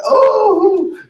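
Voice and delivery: high, loud deflated oh; a stumped little oh; mother lost for words; mock surprise. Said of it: "I was like".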